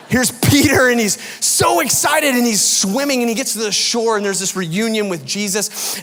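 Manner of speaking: loud, high-pitched burst of excitement; excited storytelling. Retold or imitated. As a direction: gush it out as an overexcited retelling. Animated retelling of Peter's excited swim.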